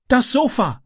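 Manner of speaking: high, urgent cry of alarm; calling out. A narrator's reading. Boy sees the burning sofa, calls out. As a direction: raise the pitch sharply in alarm. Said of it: "rief er".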